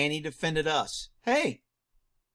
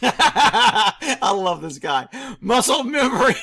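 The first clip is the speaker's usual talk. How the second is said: louder, laughing through the words; laughing delight; 2 semitones higher, 6 dB louder, slower than usual. Real, not acted. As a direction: crack up while praising him.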